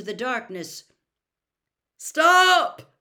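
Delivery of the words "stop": high, very loud desperate yell. A narrator's reading. Father halts the wizards firing at his son, yelling. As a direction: yell to halt an attack. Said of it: "yelled a voice he recognized".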